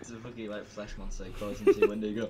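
usual speaking voice

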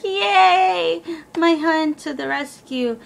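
greeting her fiance back, high happy cheer; excited cheer. Retold or imitated. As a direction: squeal with delight that help has come. Relieved when fiance arrives.

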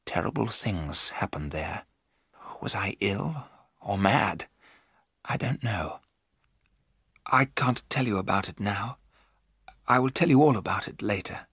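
soft, haunted near-whisper; hushed whisper. A narrator's reading. Jonathan tells Mina of terrible things, hushed. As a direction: speak softly, as if too ill to tell it. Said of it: "Jonathan whispered".

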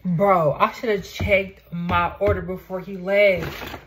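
loud, slow frustrated outburst; upset about the missing burger; mad. Real, not acted. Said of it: "That just made me so mad".

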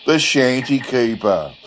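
ordinary delivery, nothing marked

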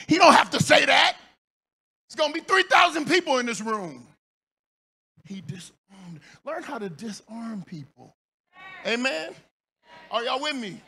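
normal talking voice

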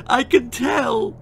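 high, loud, slow wail; bawling. Acted. Mother Sissy despairing, high loud wail. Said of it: "bawled Sissy".

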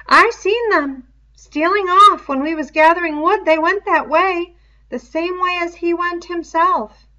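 put-on fussy, clever boy explaining eagerly; Piggy's voice. Piggy's dialogue in a reading.

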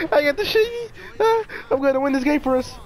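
ordinary talk

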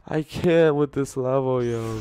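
normal talking voice

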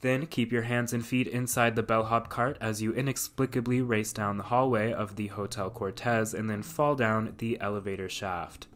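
ordinary delivery

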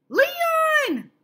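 very high, loud shout of a name; child crying out to a friend; excited cry. Acted. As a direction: yell the name in sudden discovery. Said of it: "I cried".

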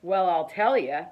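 usual speaking voice

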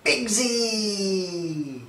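usual speaking voice

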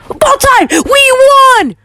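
high-pitched victory shout; too loud. Real, not acted. Gamer telling the boys they won the match, too loud. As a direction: cheer the win at the top of your pitch. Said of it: "I'm sorry I'm too loud".

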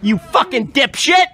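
real furious shout, high and loud; angry. Real, not acted. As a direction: yell it at them in outrage. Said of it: "Why are you so angry?".

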